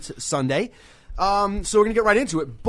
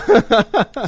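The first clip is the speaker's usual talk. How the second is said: loud burst of laughter; 7 semitones higher, 9 dB louder than usual. Real, not acted. Show going off the rails.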